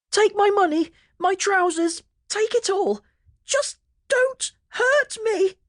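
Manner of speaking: very high, pleading wail, breaking into a cry. A narrator's reading. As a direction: beg for mercy in a high wail. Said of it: "Pete wailed".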